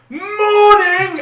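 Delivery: very high loud Morning; shouted greeting. Retold or imitated. Greeting a deaf customer, loud shout. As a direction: shout it to a deaf old man. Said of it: "I'm gonna have to shout".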